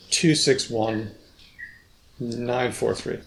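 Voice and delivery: "two six one", "nine four three", each group falling at its end; boundary fall intonation. Shown as a demonstration. Trying out falling group tones for the researcher. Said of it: "you gave what we call the boundary fall intonation".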